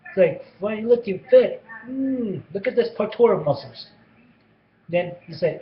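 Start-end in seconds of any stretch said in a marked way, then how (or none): 0.6-3.9 higher, louder mock admiring teacher; lightly hammed-up brash teacher. Mr Walters admires Eric's muscles.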